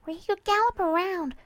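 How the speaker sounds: put-on high, girlish character voice; Dinky, wistful young mare. Mare telling her friend about her dad, wistful. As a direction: share a childhood belief in a high, tender voice.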